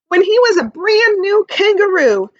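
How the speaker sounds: lifted, bright read-aloud voice; teacher reading a story to the class, lively; storybook reading. A narrator's reading. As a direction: read it like a picture book to kids. Reading picture book aloud.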